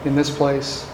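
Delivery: soft, low, slow closing prayer; hushed, measured pulpit prayer. Acted. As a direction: pray quietly, letting each phrase settle.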